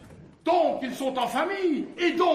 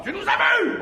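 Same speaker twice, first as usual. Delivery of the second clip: loud, high, bold challenge; brazen, raised-voice question; blunt direct question; 6 semitones higher, 7 dB louder than usual. Acted. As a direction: ask it outright at full voice. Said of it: "je lui demande franco".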